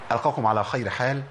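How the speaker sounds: ordinary delivery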